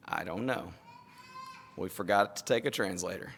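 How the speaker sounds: low, quiet, halting reply; retold sheepish admission. Preacher retells admitting to Wesley they forgot a translator, sheepish and quiet. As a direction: admit it softly and low, with pauses.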